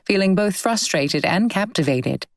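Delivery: plain reading voice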